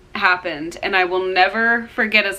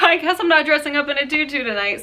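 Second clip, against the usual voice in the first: higher, quick retold quip; imitated dad joking; 7 semitones higher, faster than usual. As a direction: toss off dad's joke, high and fast. Voices dad's joking remark.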